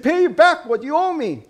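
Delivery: high-pitched, forceful demand. A narrator's reading. Servant who choked his debtor wants payment, demanding. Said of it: "he demanded".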